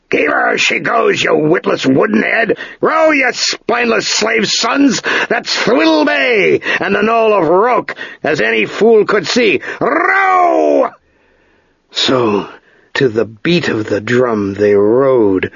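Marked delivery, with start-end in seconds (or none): 0.1-10.9 much higher, slow scornful yelling; furious ship master. A narrator's reading. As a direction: berate the crew like a raging captain. Master yells at rowers.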